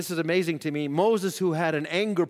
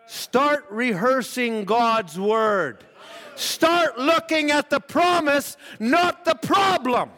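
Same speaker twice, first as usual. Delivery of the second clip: slow, higher, louder exhortation; full-force preaching; 5 semitones higher, 4 dB louder, slower than usual. Acted. Preacher urging the congregation, slow and forceful. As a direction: drive the point home to the congregation.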